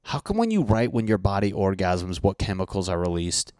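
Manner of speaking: usual speaking voice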